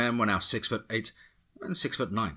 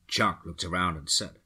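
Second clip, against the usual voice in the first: usual speaking voice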